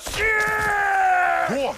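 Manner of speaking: very loud, high startled yell; alarmed shout. Acted. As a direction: yelp it as you lose your footing. Brock falling, sudden alarm.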